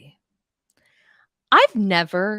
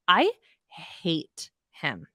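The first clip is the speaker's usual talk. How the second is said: slow, stretched and heavy; drawn-out disgust; much slower than usual. Real, not acted. Host repeating her hatred of Louis, slow and drawn out. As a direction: let each word drag with loathing.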